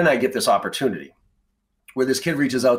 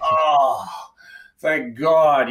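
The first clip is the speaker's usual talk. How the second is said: relieved exclamation; 5 semitones higher, 4 dB louder, much slower than usual. Retold or imitated. Relief when Jack admits mistake.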